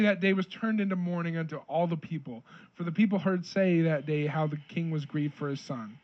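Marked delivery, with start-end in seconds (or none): none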